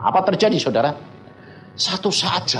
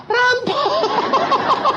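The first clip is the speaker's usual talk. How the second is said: very high drawn-out wail, played for laughs; hammed-up panicked cry; terrified scream. Preacher mocking his own screaming at robbers, terrified. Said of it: "teriak-teriak".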